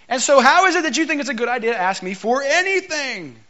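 mock the stranger who dared ask for water, rising into indignation; sassy Samaritan woman. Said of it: "that is not how it sounded".

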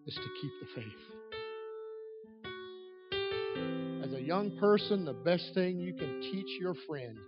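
building, impassioned preacher over music; fervent preaching. Real, not acted. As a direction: preach it to the rafters.

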